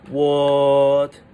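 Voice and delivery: "What" held long on one level pitch, first tone; nonchalant surprise. Shown as a demonstration. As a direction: stretch "What" evenly, feigning nonchalance.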